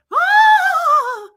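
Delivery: long, high, loud wail; imitated scream. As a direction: shriek as if rolling away helplessly. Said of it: "She's like".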